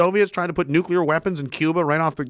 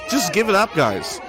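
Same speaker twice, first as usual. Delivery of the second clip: mock shout; 5 semitones higher than usual. Real, not acted. Taunting the police on video, a mock shout. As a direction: call out to the police, amused.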